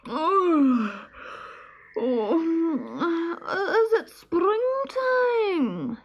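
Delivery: high, slow sleepy squirrel voice. Acted. Woken squirrel asking the stoat, slow and sleepy. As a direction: drag yourself awake, slow and high. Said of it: "he asked sleepily".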